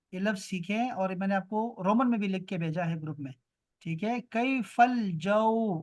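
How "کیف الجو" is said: "کیف الجو" stretched out very slowly; slow model pronunciation. Shown as a demonstration. Teacher introducing a new Arabic question, slow and deliberate. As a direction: draw out each syllable of "کیف الجو".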